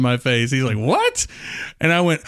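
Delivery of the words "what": high, incredulous kid imitation; little kid's baffled voice. Boy stares at clueless adult, imitated baffled kid voice. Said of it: "the accent and voice that he had".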